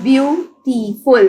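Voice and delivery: Beautiful slowly split as byu, tee, ful; syllable by syllable. Shown as a demonstration. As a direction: pause between the syllables of beautiful. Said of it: "Put a dot between syllables".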